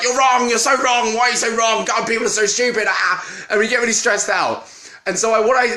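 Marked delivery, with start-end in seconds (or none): 0.0-3.5 raised, higher mock rant at online opponents; hammed-up exasperated typing rant; mock angry arguer. Imitating an online arguer ranting at a stranger, mock angry.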